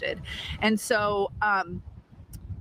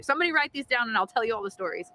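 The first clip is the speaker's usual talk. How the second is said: usual speaking voice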